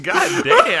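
high laughing exclamation; laughing god damn. Real, not acted. Startled friend exclaiming, laughing loud. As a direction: blurt it out amazed. Reacts to slapped CDs.

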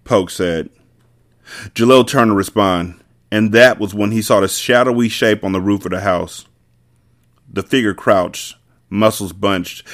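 ordinary delivery

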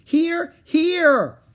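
very high, loud repeated call; excited high shout; calling from above. A narrator's reading. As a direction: holler down from a treetop. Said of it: "Annie's voice came from above".

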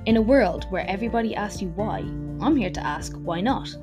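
announcer intro voice over music; upbeat show opener; podcast intro. Acted.

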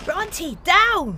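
high urgent shout; sharp command. Acted. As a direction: yell a command at the dog. Ordering dog down before blast.

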